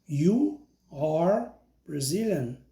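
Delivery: slow, clear "I am Brazilian" for pupils to repeat; slow model sentence. Shown as a demonstration. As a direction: say each word of "I am Brazilian" slowly so they can copy. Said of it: "Então, repita comigo". (devagar e claro, para o aluno repetir)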